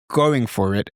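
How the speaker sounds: usual speaking voice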